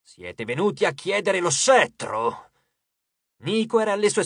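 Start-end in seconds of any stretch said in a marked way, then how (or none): 0.0-2.5 slow, lightly voiced god, intense; a hint of an ominous deity; menacing god. A narrator's reading. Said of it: "Era profonda e intensa".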